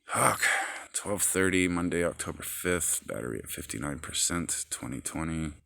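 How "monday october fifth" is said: normal talking voice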